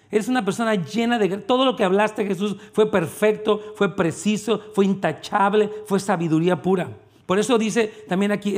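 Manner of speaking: ordinary talk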